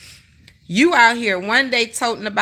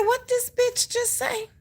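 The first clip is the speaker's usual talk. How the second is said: shocked disbelief; 12 semitones higher, 7 dB quieter than usual. Retold or imitated. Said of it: "I said".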